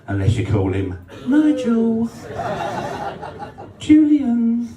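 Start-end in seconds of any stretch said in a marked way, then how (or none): 1.3-4.7 lifted high and camp, laughing. Pretend. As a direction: say the names high and giggling. Punchline said in a high voice.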